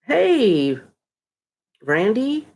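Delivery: normal talking voice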